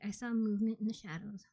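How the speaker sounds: ordinary delivery, nothing marked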